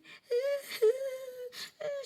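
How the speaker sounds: high piercing wail of terror; imitated wailing scream. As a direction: shriek like the terrified crowd. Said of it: "Bahkan ada yang menjerit".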